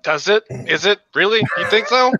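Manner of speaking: sarcastic. Acted. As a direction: ask back in open sarcasm. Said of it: "You couldn't tell the sarcasm there?".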